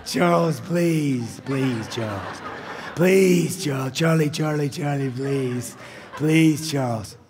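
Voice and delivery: hammed-up begging, stretching out "Charlie, please"; mock begging. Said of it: "This is begging".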